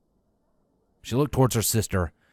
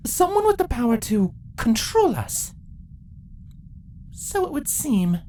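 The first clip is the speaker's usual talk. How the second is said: voiced queens.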